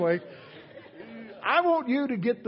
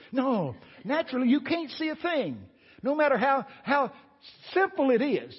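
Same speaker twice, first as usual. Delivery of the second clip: preaching; 9 semitones higher, 3 dB louder than usual. Real, not acted. Preaching at full force.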